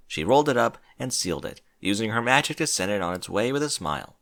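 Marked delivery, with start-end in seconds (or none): none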